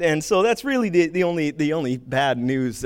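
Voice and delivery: usual speaking voice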